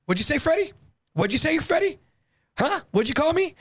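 high, rising confrontational questions; indignant challenge. Retold or imitated. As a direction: imitate someone daring him to repeat it. Mark confronting Freddie over his name.

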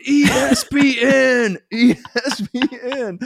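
high, slow, laughing mock yell of ESPN; stranger yelling ESPN. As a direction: chant ESPN like a crazed fan.

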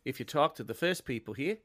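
laid-back Australian river guide; Australian accent. Acted. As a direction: tell a local tale in an Australian accent. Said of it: "whose Australian accent".

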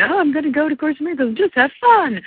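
high, wide-eyed mock innocent voice; innocent lamb. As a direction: play the lamb going happily to slaughter. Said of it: "this innocent lamb going".

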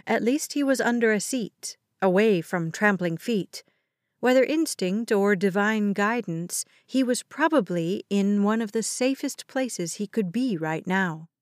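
plain reading voice

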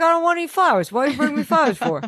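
high, fast Boston griping; Boston accent. Retold or imitated. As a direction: protest in a hammed-up Boston accent. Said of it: "he's from Boston in my mind".

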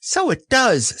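very high little squeak; small, high-pitched echo; delighted Piglet. A narrator's reading. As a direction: pipe up in tiny-animal wonder. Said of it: "said Piglet".

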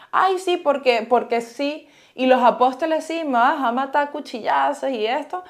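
scoffing, put-on credulity; mock agreement. Mocks the idea of dying pointlessly.

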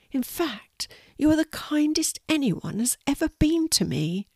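high-pitched tiny spider, touched; heartfelt thanks in a small creature voice; little spider, high and earnest; grateful spider. Acted.

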